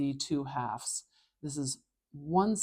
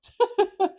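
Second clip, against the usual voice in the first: high loud laugh at her slip; a real laugh breaking out; burst of laughter; 10 dB louder than usual. Real, not acted. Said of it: "laughs at her own slip".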